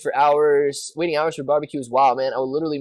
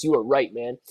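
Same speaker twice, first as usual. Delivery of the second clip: ordinary talk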